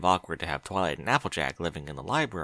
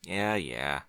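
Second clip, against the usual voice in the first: softer, dismissive mumble; mumbling; 4 semitones lower, 6 dB quieter than usual. Acted. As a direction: brush her off under his breath. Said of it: "he mumbled".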